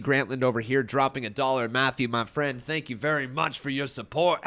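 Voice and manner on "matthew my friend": normal talking voice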